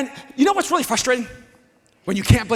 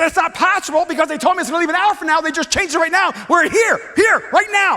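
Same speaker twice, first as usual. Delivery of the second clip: loud, fast, furious pleading; angry protest; 5 semitones higher, 4 dB louder than usual. Retold or imitated. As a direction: push back hard, nearly shouting. Arguing with gate agent.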